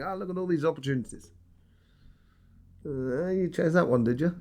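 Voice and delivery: mock devil taunt, hushed; teasing devil. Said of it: "The devil is just there sort of like, sort of saying".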